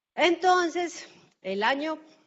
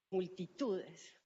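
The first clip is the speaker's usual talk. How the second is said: soft, slow deadpan quip; dry irony; 3 semitones lower, 8 dB quieter, much slower than usual. Real, not acted. Speaker to audience after asking who knows Fondo Acción, dry irony. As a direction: drop it quietly and slowly, mock-impressed. Joking at few raised hands.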